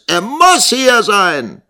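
high, loud, drawn-out insistence; shouting. A narrator's reading. Schoolmaster insisting the boy is there, shouting. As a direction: bellow it slowly, refusing to believe it. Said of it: "schrie Squeers".

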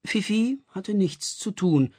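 ordinary delivery

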